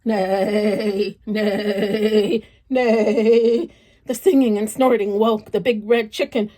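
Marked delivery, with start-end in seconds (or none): 0.0-3.7 grand neighing, building louder to the last; storyteller voicing a proud horse. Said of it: "the horse snorted and grandly sang".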